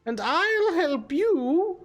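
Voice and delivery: put-on high, lilting old hag voice; hag character. Hag offers the party a deal for the treasure, wheedling hag voice. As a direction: play the bargaining hag, drawn out in places.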